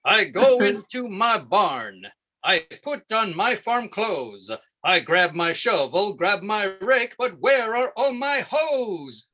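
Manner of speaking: rhythmic farmer rap with drawn-out refrain; chanted comic farm poem, punching the refrain; mock urban poetry. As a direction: recite it like a corny hayseed trying to rap. Farmer performs his urban poetry.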